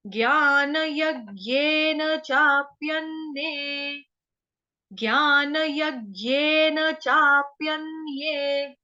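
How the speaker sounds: ज्ञान यज्ञेन चाप्यन्ये chanted slowly syllable by syllable; slow recitation. Shown as a demonstration. Teacher recites shloka line slowly for students to copy. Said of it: "हम बोलते हैं ज्ञ".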